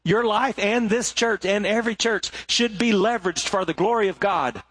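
normal talking voice

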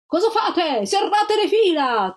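high, loud, slow shouted order; rally the retreating ranks; thundering officers. Acted. Officers bellow at their retreating men, loud and slow. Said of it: "tuonarono gli ufficiali".

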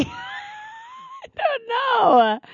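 very high, drawn-out wail; playful exasperation. Pretend. Co-host asked why send a seashell, playful exasperation. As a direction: stretch it into a squealed protest.

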